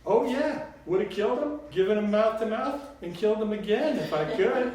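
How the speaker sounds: high, loud, emphatic Southern grandmother; Southern drawl. A narrator's reading. Grandmother joking about murdering Papa, loud Southern drawl. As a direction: proclaim it boldly in a Southern voice. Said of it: "in her sweet southern drawl".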